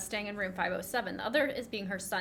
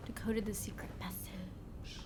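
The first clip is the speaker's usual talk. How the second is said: whisper; 3 semitones lower, 9 dB quieter than usual. Acted.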